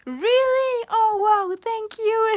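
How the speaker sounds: high, loud, over-the-top delighted English; tipsy girl thanking the CEO, hammed-up gushing; gushing thanks. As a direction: play the starstruck employee, squealing thanks. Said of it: "J'étais en mode".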